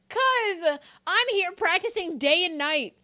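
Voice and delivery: loud, high-pitched retort; indignant comeback. Pretend. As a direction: belt it out in mock outrage. Mock-indignant comeback to friends.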